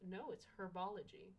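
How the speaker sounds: no, it's herbology with a sounded H; herbology, H clearly voiced at the start. Shown as a demonstration. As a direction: stress the H in herbology. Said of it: "'cause it's herbs".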